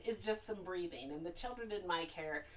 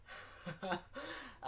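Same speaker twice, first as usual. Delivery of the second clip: light laugh; 6 semitones higher, 7 dB quieter than usual. Real, not acted. Laugh at puppet's pose.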